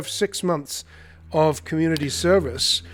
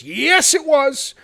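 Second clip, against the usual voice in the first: high, loud mock insistence; defensive; 11 semitones higher, 7 dB louder, much slower than usual. Director argues back at the artist, high defensive insistence. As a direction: imitate her shooting up in pitch to defend it. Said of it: "And she starts defending".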